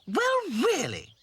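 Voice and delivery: high, slow indignant huff; cross. Acted. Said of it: "said Dotty crossly".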